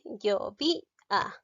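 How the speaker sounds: slow, separated syllables in "Yo vi a". Shown as a demonstration. Teacher checking which syllable sounds strongest, slowly syllabifying "Yo vi a". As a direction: stretch out each syllable of "Yo vi a". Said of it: "la vi suena más".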